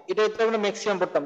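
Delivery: usual speaking voice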